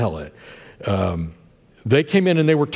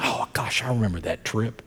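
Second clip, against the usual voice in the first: soft wistful whisper; 7 dB quieter than usual. Retold or imitated. Wife's fond memory quoted.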